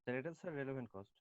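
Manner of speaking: soft, under-projected answer; too quiet. Real, not acted. Student answering the teacher about relevant cost, too quietly. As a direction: answer quietly, barely reaching the listener. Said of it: "আপনি একটু জোরে বলেন".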